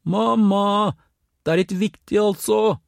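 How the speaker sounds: high, loud urgent call. A narrator's reading. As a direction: yell into the house, insisting. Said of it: "ropte enda høyere inn i huset". (roper enda høyere inn i huset)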